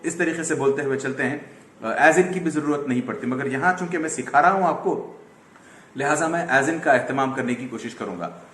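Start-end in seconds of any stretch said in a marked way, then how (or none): none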